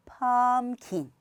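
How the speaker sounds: 펌킨 slowly, 펌 long; 펌킨, 펌 long, 킨 short; slow two-beat pumpkin. Shown as a demonstration. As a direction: draw out 펌 in 펌킨. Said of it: "스타카토로 하시는 게 아니라".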